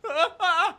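two loud, high yelps; startled yelps. Real, not acted. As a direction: yelp in real alarm. Cries out while playing.

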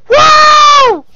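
long, very high triumphant "woo"; victory whoop. Real, not acted. Gamer cheering their own kill, victory whoop. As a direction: whoop loudly, held high.

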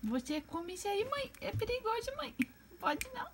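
high soft mock scolding; high baby voice warning the bird; baby talk scolding. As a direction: gently tell the pet no.

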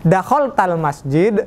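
"dakhol tal masjid" slow, rising at the end as a question; slow questioning rise on "masjid"; question intonation. Shown as a demonstration. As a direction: ask "dakhol tal masjid" slowly with a lift on "masjid". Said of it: "Nah, ketika tanya".

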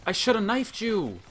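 high, loud, frustrated yell; angry protest. Real, not acted. Player to the opponent who killed them, in yelled frustration. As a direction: shout it as an aggrieved complaint.

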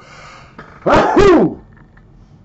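big sudden sneeze; loud sneeze. Real, not acted. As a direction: sneeze hard and high. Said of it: "I hardly ever sneeze on snuff".

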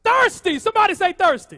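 loud, high preacher's call; rousing call-out. Real, not acted. Preacher getting the congregation to say thirsty, rousing call-out. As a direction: fire the word out to the whole room.

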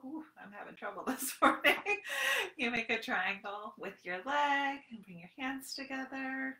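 ordinary delivery

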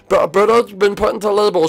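usual speaking voice

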